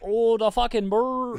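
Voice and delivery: high, loud, slow Philly "Oh, the fucking bird"; Philly fan accent, drawn-out O. Shown as a demonstration. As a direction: stretch out the O like a Philly sports fan. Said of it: "something about the O's are".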